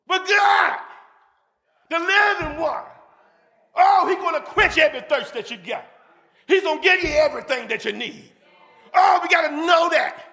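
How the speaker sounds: high, ringing preacher's shout; fervent hallelujah preaching, pitched high; exultant preaching. Real, not acted. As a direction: cry it out to the whole church. Preaches at full force.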